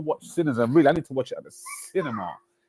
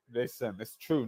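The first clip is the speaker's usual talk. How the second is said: usual speaking voice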